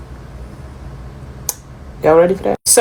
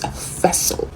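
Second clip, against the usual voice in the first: whispered and much softer; hushed; 10 dB quieter than usual. Real, not acted. Speaker naming the child's purpose for the deity, whispered. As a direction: let it fall away to a whisper. Dramatic reveal in mystical monologue.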